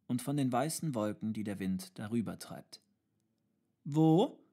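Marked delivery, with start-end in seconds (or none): 3.8-4.4 put-on loud, high child's cry, rising; calling out. A narrator's reading. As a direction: call out the question like a child. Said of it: "rief der Kleine wieder". (laut gerufene Kinderfrage)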